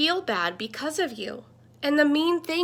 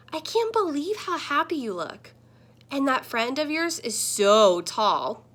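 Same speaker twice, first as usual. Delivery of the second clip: usual speaking voice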